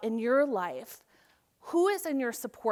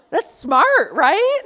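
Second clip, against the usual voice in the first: much higher and louder, rising on right; excited approval; 8 semitones higher, 14 dB louder, much slower than usual. Real, not acted. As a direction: share the delight with the crowd. Reacting to cashier's wisdom.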